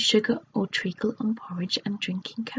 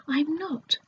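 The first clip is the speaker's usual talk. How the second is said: much higher, indignant denial; insistent; 7 semitones higher than usual. Acted. Goldilocks insisting to Moonface she saw someone, indignant and high. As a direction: insist sharply.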